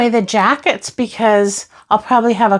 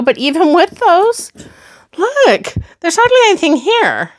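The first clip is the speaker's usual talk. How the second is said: much higher, bright look; high, eager show-off; excited pride; 7 semitones higher than usual. Real, not acted. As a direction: point proudly at the empty space. Proud of the sparse drawer.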